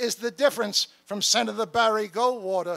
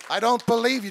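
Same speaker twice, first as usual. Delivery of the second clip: ordinary talk, nothing marked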